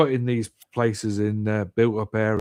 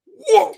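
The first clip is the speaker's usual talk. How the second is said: high, loud whoa of the gush bursting; a big excited whoosh; imitated whoosh; 10 dB louder than usual. Describing the muck coming out, high loud whoosh.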